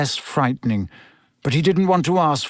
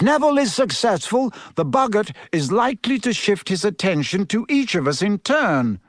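encouraging teacher, pitched higher; Professor Lupin; 7 semitones higher than usual. Acted. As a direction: coach a pupil with lifted, lively intonation.

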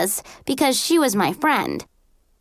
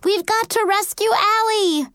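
high, urgent cry to the rescue; distant scream; 11 semitones higher than usual. A narrator's reading. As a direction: call out urgently, pitched way up. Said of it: "heard Sophie scream".

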